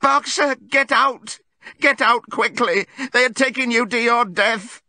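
high, loud, desperate screamed warning; terrible cry. A narrator's reading. Clover to Boxer in the van, terrible cry. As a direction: shriek it to save a friend's life. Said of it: "cried Clover in a terrible voice".

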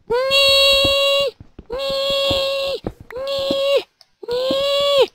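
very high squeaky nee. Acted. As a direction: squeak it out like a cartoon. Squeaky silly noises while playing.

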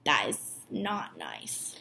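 low, hushed and slow; soft aside. Real, not acted. Teacher gently scolding the mean dogs to her class.

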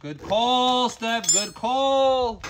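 high, loud, stretched-out cheer; jubilant praise. Real, not acted. Streamer cheering Steph for the torch idea, jubilant praise. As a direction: holler your congratulations.

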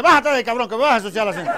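loud, high mock outrage; angry scolding. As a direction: yell the kid out of the car. Father scolds excited boy in joke. (regaño a gritos, furioso)

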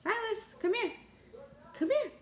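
high calling voice with a rising lilt. Real, not acted. Mother calling her toddler over.

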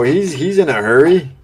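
ordinary talk, nothing marked

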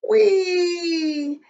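long, high squeal sliding gently down; high drawn-out whee. A narrator's reading. Mouse losing his footing on the bank, squealing whee. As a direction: cry out as if sliding downhill.

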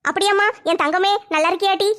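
normal talking voice

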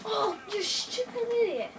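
high, loud exasperated outburst; attempted gamer rage, pitched high; trying to be angry. Real, not acted. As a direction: rail at the player who killed you. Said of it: "I'm trying to be angry".

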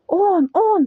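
high, voiced, urgent pointing-out; stage whisper. A narrator's reading. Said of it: "прошепотів паць". (удаваний шепіт)

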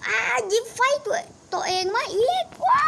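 high, loud alarmed yell in a put-on voice; panicked shout. Seeing the tank catch fire, high panicked shout.

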